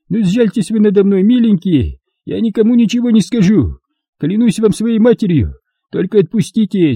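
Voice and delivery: high, rushed pleading for life; desperate begging. A narrator's reading. Trader begging captors to spare him, desperate. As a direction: beg as if for your children.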